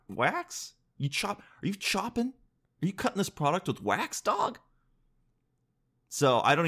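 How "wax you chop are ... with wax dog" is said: mock outraged questioning, higher; incredulous. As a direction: demand it in disbelief. Mock confronting chocolate maker.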